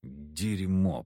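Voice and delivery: low, soft muttered curse; grumbling. Acted. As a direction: grumble it under your breath. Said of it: "проворчал я".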